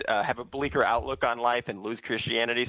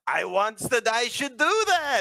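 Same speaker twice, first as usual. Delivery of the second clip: Bobby's mock accent; 9 semitones higher, slower than usual. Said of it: "Bobby starts doing impressions of him".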